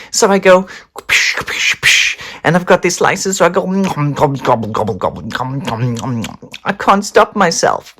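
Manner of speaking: can't stop stuffing it in; greedy frenzy. Acted.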